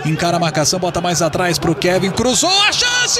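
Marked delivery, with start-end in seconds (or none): 2.1-3.2 high rising shout; excited build-up. Real, not acted. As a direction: build toward the goal. Cross creates chance.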